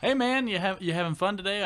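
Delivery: high, loud friendly hail; cheery greeting. Retold or imitated. Storyteller hailing his coworker in the bathroom, cheery.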